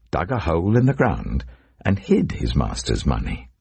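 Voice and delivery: ordinary delivery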